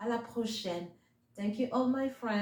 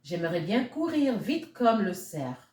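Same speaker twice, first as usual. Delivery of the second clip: French story reading; 4 dB louder than usual. A narrator's reading. Reading the French version to children, lilting storyteller voice. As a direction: tell the tale to little ones in French.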